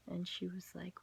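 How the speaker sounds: ordinary talk, nothing marked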